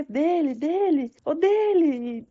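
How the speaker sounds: high, drawn-out calling of dele; crying out. Retold or imitated. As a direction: stretch the last dele long and high like a plea. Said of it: "não sei o que".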